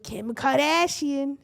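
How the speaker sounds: slow, drawn-out mock Boston accent. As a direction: imitate an overdone Boston accent, stretching it. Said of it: "she also has this, like, fucking accent".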